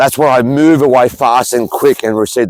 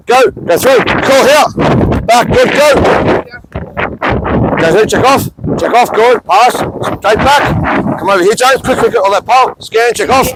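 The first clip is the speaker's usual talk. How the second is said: calling the drill; 7 semitones higher than usual. Real, not acted. Coach shouts quick cues at the players mid-drill. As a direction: drive the players through the drill.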